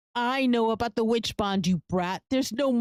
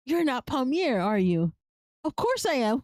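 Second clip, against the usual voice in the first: ordinary talk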